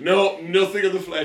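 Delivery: high, loud, slow mock outrage; hammed-up refusal. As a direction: refuse emphatically, played for laughs. Acting expert's comic refusal.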